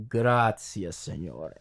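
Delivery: low, bitter murmur to the crucifix; Salieri's line. Retold or imitated. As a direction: mutter it low and resentful to God. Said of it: "all angry, and he says".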